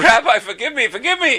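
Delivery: high-pitched mock begging with a laugh; comic retold plea, voice shooting up; pleading. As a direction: imitate a mortified man begging pardon. Smacker begs the rabbi's forgiveness.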